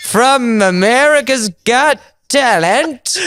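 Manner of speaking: high, very drawn-out mock announcer; stage introduction. Comic mocking how his friend brings him up, drawn-out emcee. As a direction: stretch it out like a hammed-up emcee. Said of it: "and you bring me up".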